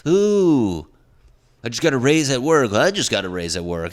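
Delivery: high, loud showy crowing; mock boasting. Coworker bragging at work about a raise, mock boastful. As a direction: put on an over-excited brag. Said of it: "no one's boasting to bond".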